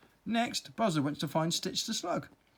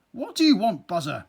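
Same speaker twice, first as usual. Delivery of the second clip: curt, loud snap; snapping; 4 semitones higher, 8 dB louder than usual. Acted. Earwig snapping at the buzzing bee, loud and curt. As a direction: snap at him, fed up. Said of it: "snapped Wiggy".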